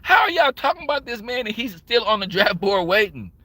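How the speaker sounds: retold outrage at the draft chatter; outraged. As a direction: demand it like you can't believe them. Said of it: "I'm like".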